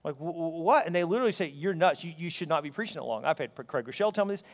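ordinary talk

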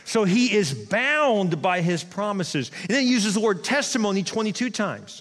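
ordinary delivery

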